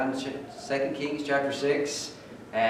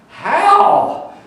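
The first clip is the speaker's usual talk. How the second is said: a sudden, much higher and louder cry of disbelief; stunned disbelief; 14 dB louder than usual. Retold or imitated. Preacher reacting to the mother's story, shocked disbelief. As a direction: gasp it out as if you can't believe it. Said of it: "I'm really reading those words and thinking".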